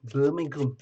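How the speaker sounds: trying out a Birmingham accent on the city's name. Shown as a demonstration. Host trying the city's name on a viewer, Birmingham accent. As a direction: say it as a local from Birmingham would. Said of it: "Do you have a Birmingham accent?".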